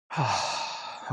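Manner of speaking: soft, unvoiced sigh to steady breathing; real sigh while catching breath; shaky breath out. Real, not acted. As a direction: let out a long calming breath. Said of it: "I'm hyperventilating".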